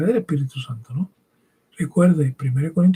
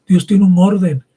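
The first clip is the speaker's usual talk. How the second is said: loud, firm declaration; loud preacher emphasis; emphatic preaching; 3 semitones higher, 8 dB louder than usual. Real, not acted. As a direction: proclaim it with force, louder. Preacher stressing order.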